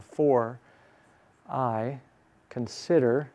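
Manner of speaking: one word at a time, long pauses; slowed down. Shown as a demonstration. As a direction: stretch it out, pausing after each word. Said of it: "I might slow it down".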